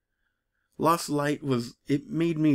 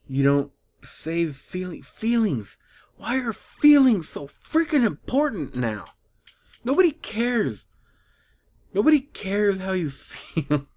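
higher, exasperated outburst; real angry rant; 5 semitones higher than usual. Real, not acted. Reader fed up with feelings in Transformers, angry rant. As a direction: get worked up, punching each phrase. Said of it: "I'm getting angry".

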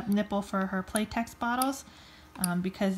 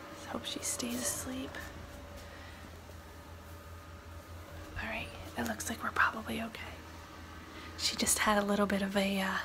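soft voiceless whisper; 6 dB quieter than usual. Real, not acted. Mom whispering updates while the baby sleeps nearby. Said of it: "trying to be really quiet".

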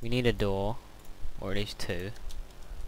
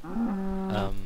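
yawning; 9 semitones higher than usual. Real, not acted. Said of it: "Sorry if I yawn too much".

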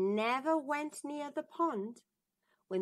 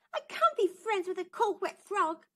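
disgusted princess; 9 semitones higher, 5 dB louder than usual. Acted. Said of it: "said the princess".

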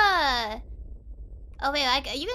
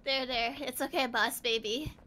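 soothing; 6 semitones lower, 7 dB quieter than usual. Pretend. Comforting the baby character.

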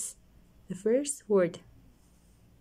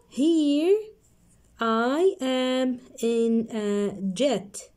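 slow reading; 3 semitones higher, much slower than usual. Shown as a demonstration. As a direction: call out, then stretch it. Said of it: "So let's read".

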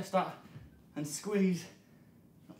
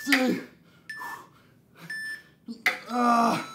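strained count and grunt; 3 semitones higher than usual. Real, not acted. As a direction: push through the rep. Last thrusters straining.

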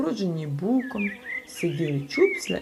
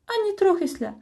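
much higher, louder impish voice; cheeky; 13 semitones higher, 3 dB louder than usual. A narrator's reading. Triaslia answers cheekily, high impish voice. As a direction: pert little creature. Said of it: "відповіла Трясля".

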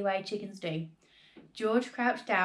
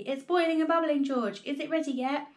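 much higher and louder, thrilled; excited cry; 6 semitones higher, 3 dB louder than usual. A narrator's reading. Father watching the pan boil, crying out thrilled. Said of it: "cried Mister Cranky".

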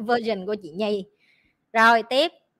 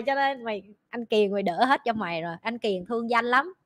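ordinary delivery

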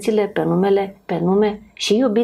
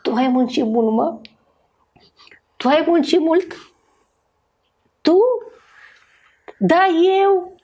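nagging wife; 7 semitones higher than usual. Retold or imitated. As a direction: imitate the scolding wife, pitched up. Nevasta ceartă soțul obosit. (nevasta îl ceartă pe soțul obosit, imitată cu vocea mult mai sus)

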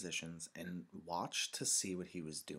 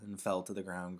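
usual speaking voice

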